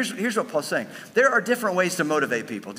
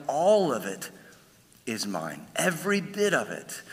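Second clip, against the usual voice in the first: patient Lord; 3 dB quieter than usual. Retold or imitated. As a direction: speak softly as a kind, patient Lord. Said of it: "and he's like".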